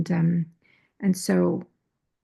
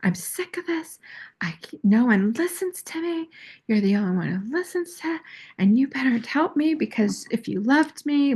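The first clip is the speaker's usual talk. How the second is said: much higher, nagging plea; guilt-trip him in a childish voice; half-hammed petulant girlfriend; mock whiny demand; 7 semitones higher than usual. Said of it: "I'm like".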